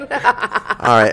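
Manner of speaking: talking through laughter; laughing. Real, not acted. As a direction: laugh while greeting. New guest arrives laughing.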